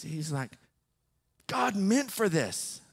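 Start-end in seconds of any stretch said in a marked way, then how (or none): 1.5-2.8 drawn-out, higher imitated declaration; super intense. Stranger in his car to the pastor, super intense. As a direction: act out his intensity, slow and high. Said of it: "getting super intense".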